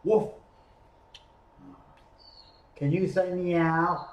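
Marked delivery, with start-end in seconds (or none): 0.0-0.3 one short bark; dog woof. Acted. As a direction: blurt a quick woof. Dog interrupts again.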